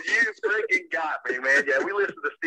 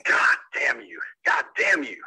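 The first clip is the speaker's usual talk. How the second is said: real cursing fury; 7 semitones lower, slower than usual. Real, not acted. Husband cursing the prank caller.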